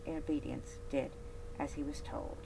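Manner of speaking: usual speaking voice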